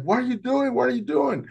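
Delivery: very high mock yell; frantic, high-pitched demand from above; retold screaming. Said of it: "the guy starts screaming up there".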